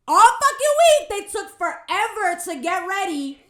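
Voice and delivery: loud, high, drawn-out complaint; exasperated yell. Real, not acted. Exasperated rant about slow friends.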